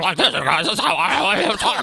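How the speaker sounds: high, slow goofy cartoon voice; cartoon dog. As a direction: ham up a silly cartoon voice. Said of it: "Scotty impersonation".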